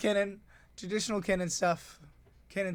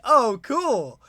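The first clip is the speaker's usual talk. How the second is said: bright, much higher, much louder "cool"; mock cheer; 8 semitones higher, 12 dB louder than usual. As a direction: play it like a cocky little win. Retold reaction to opponent's play.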